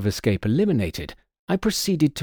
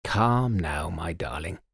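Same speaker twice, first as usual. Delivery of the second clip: softer and lower, calming her; soothing; 6 semitones lower, 3 dB quieter than usual. Acted. Husband soothing his weeping wife, softly. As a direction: hush her gently. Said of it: "patting her arm".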